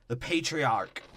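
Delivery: "patriarch" drawn out slowly as PAY-tree-ark; "patriarch" said slow and over-pronounced; odd pronunciation. Real, not acted. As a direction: stretch out "patriarch" with oddly careful syllables. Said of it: "why I said it like that".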